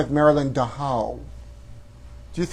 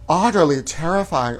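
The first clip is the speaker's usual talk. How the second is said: ordinary talk, nothing marked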